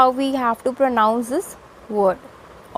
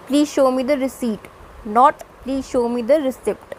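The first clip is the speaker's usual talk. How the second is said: usual speaking voice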